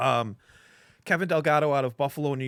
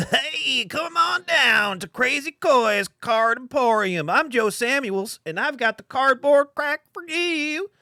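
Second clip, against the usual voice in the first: much higher, louder, slow hyped local ad man; Joe impression; 11 semitones higher, 6 dB louder than usual. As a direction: do the shop owner's over-the-top TV pitch. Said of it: "That's my Joe impression".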